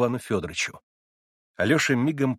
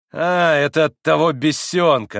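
higher, louder, slow spiteful jeer; spiteful laugh; 6 semitones higher, 8 dB louder than usual. A narrator's reading. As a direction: laugh it off with malice. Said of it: "рассмеялся он злобно". (злобный смешок)

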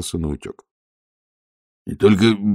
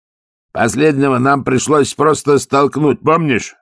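guard's voice; 7 semitones higher, 6 dB louder than usual. Acted. Guard recalls pushing donkey.

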